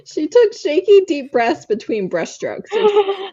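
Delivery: giggling through the line, pitch jumping up; fighting off laughter to read on; laughing while reading. Real, not acted. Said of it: "narrator laughing while reading".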